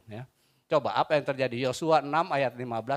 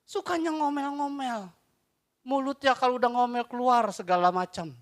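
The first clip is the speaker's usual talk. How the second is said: mocking scold; 12 semitones higher, 6 dB louder than usual. Mocking grumblers.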